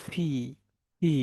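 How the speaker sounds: ফি as breath-puffed "phee"; right P sound. Shown as a demonstration. Teacher drilling the class, ফি with aspiration. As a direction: release air sharply at the start of ফি. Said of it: "কি হবে বলেন তো?".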